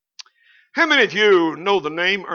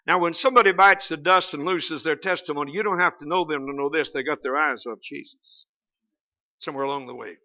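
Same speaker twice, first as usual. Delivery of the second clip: ordinary delivery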